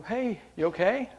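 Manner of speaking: concerned check-in. Retold or imitated. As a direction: check on her with a lifted voice. Chaplain checking on upset dying woman.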